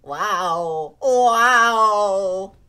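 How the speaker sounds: amazed wow. Acted. Character cheers.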